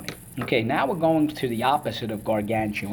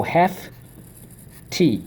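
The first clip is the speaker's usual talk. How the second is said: HEFTY pulled apart into two raised beats; syllable by syllable; 6 semitones higher than usual. Shown as a demonstration. Teacher drilling the class once more, syllable by syllable. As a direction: split HEFTY after hef, with a clear pause. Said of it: "Okay, so let's go".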